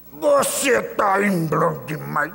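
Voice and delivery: slow menacing demon voice. Retold or imitated. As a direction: threaten slowly as the possessing demon. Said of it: "ela fala com voz de homem". (voz ameaçadora de demônio)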